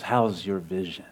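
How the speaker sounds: low, softened snarling question; understated menace, retold; imitated snarl. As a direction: drop the voice low for a hostile jibe. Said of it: "snarls and says".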